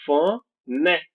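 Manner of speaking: "Phonetics" broken slowly into syllables, NET punched; stressed syllable. Shown as a demonstration. Teacher showing pupils the tonic syllable, stressed slowly. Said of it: "É a sílaba forte".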